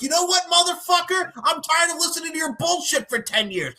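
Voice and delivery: high, shouted telling-off; furious telling-off. Pretend. Imagined reply to the president, high angry shout. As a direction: let him have it at last, yelling. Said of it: "And I would be like".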